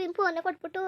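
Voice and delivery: ordinary delivery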